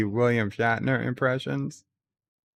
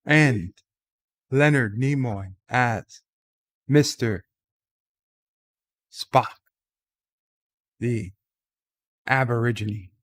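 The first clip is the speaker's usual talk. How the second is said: halting, dramatic pauses between phrases, lightly voiced Shatner; a hint of Captain Kirk, word by word with pauses; William Shatner impression. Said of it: "to do his Shatner impression".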